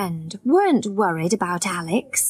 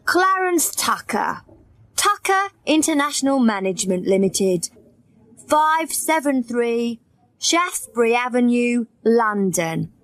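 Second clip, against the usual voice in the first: usual speaking voice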